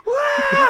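very high, loud cheering shout; imitated crowd roar. Audience as the comic closes on puking, roaring cheer. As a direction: mimic a whole room erupting.

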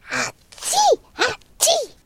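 two acted sneezes. The proud dragon sneezes twice, mock sneezes.

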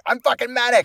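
high, loud mock yell; manic outburst. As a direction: imitate a man on a rampage. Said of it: "he was, like, kicking down doors".

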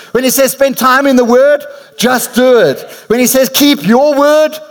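high-pitched, rousing sermon refrain; preaching at full force. Real, not acted. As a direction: preach it high, driving each command home to the congregation. Preaches a call-and-response refrain.

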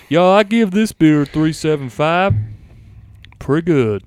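put-on Southern accent. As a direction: talk like a mock Southerner. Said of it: "gonna do a southern one".

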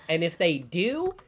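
ordinary delivery

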